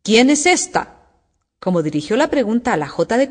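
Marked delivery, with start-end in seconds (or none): 0.0-0.9 stern queen's demand, higher pitched; severe. A narrator's reading. Queen questions Alicia before the procession, severely. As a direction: ask it severely, raised in pitch. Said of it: "la reina preguntó severamente".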